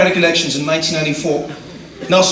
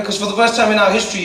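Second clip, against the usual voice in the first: usual speaking voice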